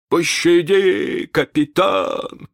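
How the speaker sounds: slow, high whimpering plea. Acted. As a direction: beg for mercy, whining. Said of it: "захныкал Старки".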